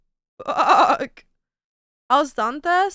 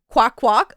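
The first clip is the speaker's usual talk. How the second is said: duck quack; 6 dB louder than usual. Acted. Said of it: "like, a duck".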